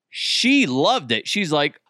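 usual speaking voice

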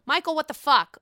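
loud, sharp call-out; exasperated outburst. Real, not acted. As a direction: yell at the TV. Ranting at cast member on screen.

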